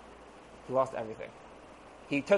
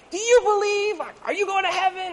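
high, loud evangelist yell; hammed-up street preacher yelling; mock screaming preacher; 5 dB louder than usual. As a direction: shout it into every face. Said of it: "he's screaming in everyone's face".

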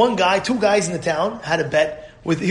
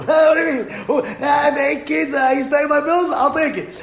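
high, hammed-up pleading justification; exaggerated exasperated excuse; mock protest; 7 semitones higher than usual. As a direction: plead your case with the voice pitched up. Employee's acted protest, justifying awful boss.

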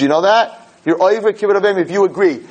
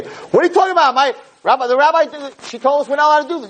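much higher, indignant and scornful; rude know-it-all; 10 semitones higher, faster than usual. Shown as a demonstration. Child lecturing mother about halacha, high and indignant. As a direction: snap back like a bratty kid correcting mom. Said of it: "You don't talk like that".

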